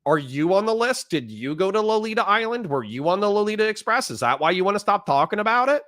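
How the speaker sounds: high-pitched, indignant grilling; real mad rant. Real, not acted. Streamer accusing a viewer of dodging the topic, indignant high rant. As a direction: demand answers, pitch kept high in outrage. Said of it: "I'm sorry for getting mad".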